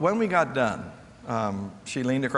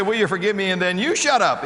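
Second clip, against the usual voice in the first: louder, higher correcting voice; emphatic correction; 8 semitones higher, 5 dB louder than usual. Shown as a demonstration. Speaker corrects the leader's wording, emphatic. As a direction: set him straight with emphasis. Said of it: "No, you don't say 'shut up.'".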